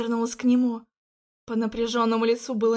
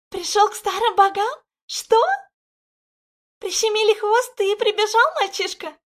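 much higher, louder aggrieved crone; hurt old guardian; 10 semitones higher, 6 dB louder than usual. Acted. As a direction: reproach him like a wounded elder. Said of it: "чувствовалась обида".

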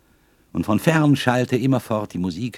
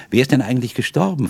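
quick, higher, hurried asking; hasty question; 6 semitones higher, 4 dB louder, faster than usual. A narrator's reading. Young man blurts his question to the lady, hastily. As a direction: blurt it out fast. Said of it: "fragte ich hastig".

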